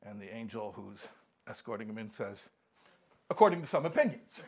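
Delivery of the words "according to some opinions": angel's punchline. Acted.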